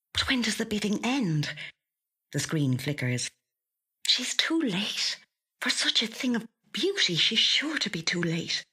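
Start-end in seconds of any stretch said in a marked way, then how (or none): 4.1-8.7 despairing whisper. A narrator's reading. Louise realising she missed the bidding, high despairing whisper. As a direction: whisper it as if all hope is lost.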